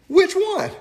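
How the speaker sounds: high squeaky comic question; confused parent. Retold or imitated. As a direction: pop it out high and baffled. Parents' punchline.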